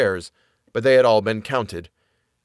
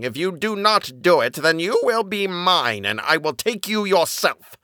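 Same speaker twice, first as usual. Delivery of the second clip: higher, menacing devil's voice; raised-pitch threat from the evil one; threatening; 6 semitones higher than usual. A narrator's reading. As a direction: threaten deliberately, leaning on each word. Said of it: "the evil one threatened him".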